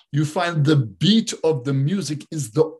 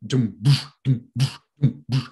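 offbeat drum demo; 2 semitones lower than usual. Shown as a demonstration. Showing how rock emphasizes the offbeats, vocal drum demo. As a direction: imitate a rock drum, accenting every second beat. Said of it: "Rock music puts the emphasis on the offbeats".

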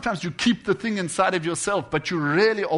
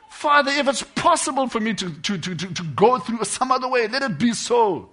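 loud, higher preaching that pours out the prayer; impassioned preaching; 4 semitones higher, 7 dB louder than usual. Real, not acted. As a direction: preach it with full fervour. Preacher raises his voice, voicing Jesus' prayer.

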